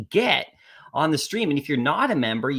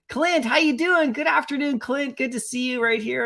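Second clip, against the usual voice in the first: excited greeting; 9 semitones higher than usual. Real, not acted. Streamer greets arriving chatter.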